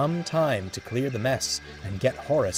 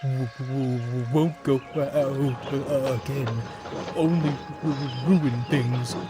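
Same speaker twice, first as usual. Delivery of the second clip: slow, broken, stammering despair; trailing off sadly; 2 semitones higher, slower than usual. Acted. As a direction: let it stammer and fade. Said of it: "Horace trailed off".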